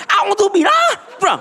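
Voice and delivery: comic furious outburst; mock shouted ta'awuz. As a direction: bang the table and shout the prayer. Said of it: "Kebrag beja".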